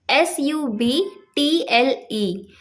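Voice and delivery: letters one by one, slowly; spelling out letters. Shown as a demonstration. Teacher spelling the word for students, slow. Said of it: "next हमारा word है सटल".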